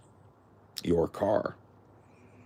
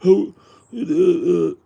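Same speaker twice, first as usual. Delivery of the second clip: high, loud, stumbling put-on voice; mock dimwit misspeller; 11 semitones higher, 9 dB louder than usual. Mocking someone who misuses your, high loud put-on voice. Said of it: "never gonna catch me saying".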